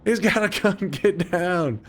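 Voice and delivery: high mock-panicked yell; hammed-up high panic, get down; panicky cop. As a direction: play the frantic cop, squeaky and scared. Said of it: "He'd be like".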